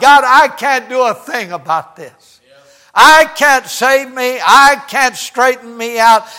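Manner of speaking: loud, insistent cry to God; impassioned preaching plea. Acted. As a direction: plead forcefully, hammering each admission.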